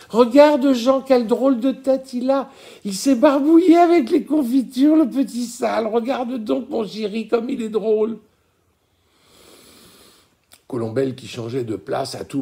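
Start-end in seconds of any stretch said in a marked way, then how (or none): 0.1-8.2 put-on much higher and louder, gleeful at the child; delighted, laughing mother; bright high amused delirium; young merry voice. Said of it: "d'un rire jeune et bruyant".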